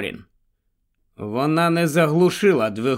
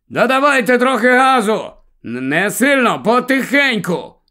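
higher, louder shouted directions; calling out; 9 semitones higher, 5 dB louder than usual. A narrator's reading. Helen directs the driver over the engine, shouting. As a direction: call over the revving engine.

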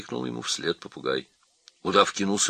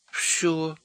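high, drawn-out letdown; disappointed squeaky voice; disappointed monkey; 10 semitones higher than usual. A narrator's reading. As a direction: sigh it out high, let down. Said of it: "разочарованно сказала мартышка".

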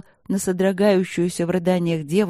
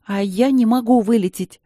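louder, higher pleading outburst; desperate plea; 5 semitones higher, 5 dB louder than usual. Acted. Princess begs the professor, loud and high. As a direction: she insists she cannot be expelled.

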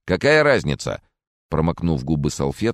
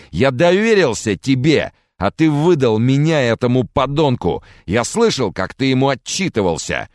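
much higher, louder accusing shout; betrayed fury; 10 semitones higher, 6 dB louder than usual. Acted. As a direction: yell at the friend who betrayed you. Charles screams at betrayer. (гневный крик преданного, выше и громче)

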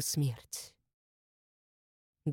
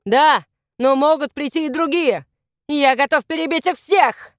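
loud, raised-pitch battle talk; shouted exchange; 6 semitones higher, 5 dB louder than usual. A narrator's reading. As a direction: call it out fiercely over distance. Shouted exchange during the chase.

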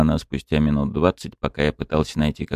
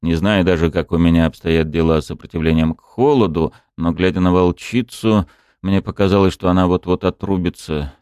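ordinary delivery, nothing marked

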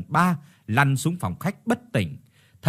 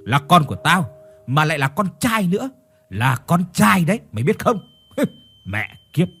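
louder, higher outburst boasting of a son; angry man; 5 semitones higher, 5 dB louder than usual. Acted. Husband boasting about his coming son, angry and loud. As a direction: hurl it out loudly, ending on a curse.

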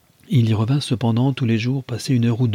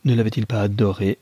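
usual speaking voice

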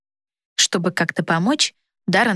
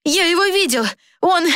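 put-on high-pitched, urgent young messenger; excited boy's cry; 11 semitones higher than usual. A narrator's reading. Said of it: "закричал мальчишка". (взволнованный высокий голос мальчишки)

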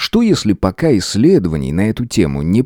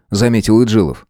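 ordinary delivery

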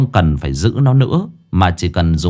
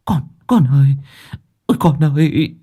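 high, wailing call; anguished, stammering cry; distraught mother crying out; 6 semitones higher than usual. Acted. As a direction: call desperately for your child. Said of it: "chị Đồng Nát cũng kêu lên".

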